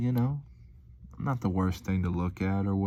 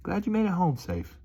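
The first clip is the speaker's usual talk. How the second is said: high, sugary well-wish; mock-sweet send-off; 11 semitones higher than usual. A delivery driver's sweet note to a customer, high and sugary. Quoting his own delivery message.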